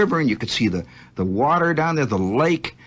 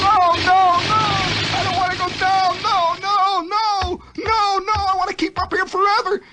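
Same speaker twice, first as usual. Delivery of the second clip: put-on high childlike pleading no; much higher boy's pleading voice; child pleading. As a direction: beg not to go down like a small boy. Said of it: "I kept thinking".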